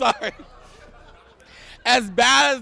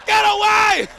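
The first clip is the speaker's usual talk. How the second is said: higher urgent shout; mock frantic cheer; 9 semitones higher, slower than usual. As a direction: scream encouragement at the screen. Rooting again, louder.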